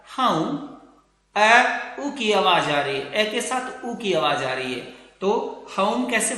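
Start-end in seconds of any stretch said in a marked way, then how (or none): 0.1-0.7 "हाउ" glides a to u; correct diphthong. Shown as a demonstration. Teacher repeating the correct form for students: gliding "हाउ". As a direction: say "हाउ" with a rounded u ending. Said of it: "होम नहीं होता".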